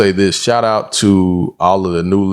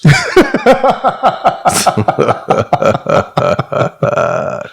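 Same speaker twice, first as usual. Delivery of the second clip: high-pitched wheezing laugh; 3 dB louder than usual. Real, not acted. Cracking up at Southern Belle joke.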